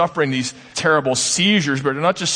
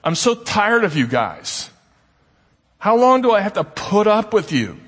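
exasperated; 8 semitones higher than usual. Retold or imitated. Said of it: "He's saying, to paraphrase".

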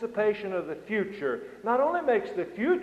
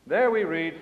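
ordinary talk, nothing marked

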